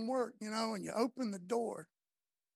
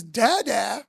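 loud imitation of a child calling dad; big, excited mock-toddler call; loving toddler; 11 dB louder than usual. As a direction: call out like a loving little boy. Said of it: "what he's really saying is, Love".

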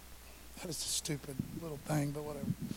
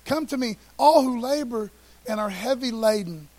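proclaimed invitation; 4 semitones higher, 10 dB louder than usual. Acted. Preacher proclaiming Jesus' invitation.

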